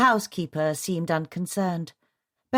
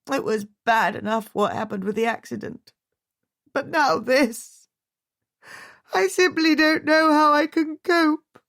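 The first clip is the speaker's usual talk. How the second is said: overwhelmed; 9 semitones higher, 4 dB louder than usual. A narrator's reading. Widow near breaking.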